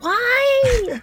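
high, loud wailing why; anguished cry. Real, not acted. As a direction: cry out in mock despair. Mock despair at the song.

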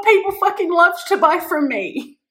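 all-in hyped affirmation; excited declaration. Shown as a demonstration. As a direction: shout "People fucking love to buy from me" like a battle cry. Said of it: "I was saying this before".

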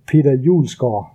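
"Julesgaard" with a native Danish ending, the final r swallowed; Danish pronunciation. Shown as a demonstration. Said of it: "pronouncing it, uh, more like".

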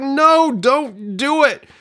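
high, slow, drawn-out plea at the screen; hammed-up viewer begging; mock pleading cry. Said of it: "people are like".

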